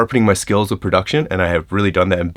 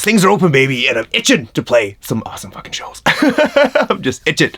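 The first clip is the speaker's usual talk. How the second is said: excited; 7 semitones higher than usual. Real, not acted. Excited about touring.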